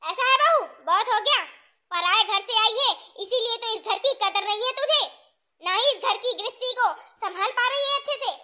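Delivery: mother-in-law losing her patience; angry scolding. Acted. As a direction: scold the daughter-in-law sharply.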